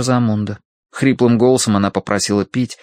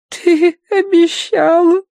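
put-on slow, high, whining child; whiny little sibling; 5 dB louder, slower than usual. A narrator's reading. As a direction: whine it like a sulky little girl. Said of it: "захныкала Агнес".

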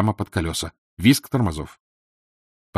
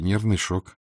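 plain reading voice

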